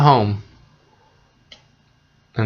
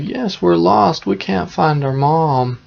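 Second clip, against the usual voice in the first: put-on higher, slowed-down child's plea; plaintive storybook children's voice; lost children; 7 semitones higher than usual. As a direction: voice two lost little children, pitched up. Lost children answer the witch.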